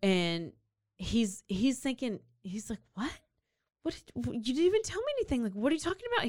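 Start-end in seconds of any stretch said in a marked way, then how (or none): 2.9-6.3 higher, fast, sputtering bewilderment; mock freaking out. Alan baffled by her sudden confession, mildly freaking out. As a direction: stumble into it, then rush out the confused questions. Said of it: "He's, like, freaking out".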